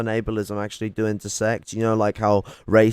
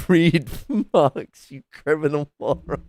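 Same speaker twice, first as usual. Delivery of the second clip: louder, higher jeer through laughter; laughing insult; 6 semitones higher, 5 dB louder than usual. Real, not acted. Streamer insulting a debater, laughing jeer.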